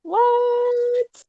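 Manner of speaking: sudden high squeak of disbelief; shocked. Real, not acted. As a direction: blurt it out in shock. Shocked at the ricochet.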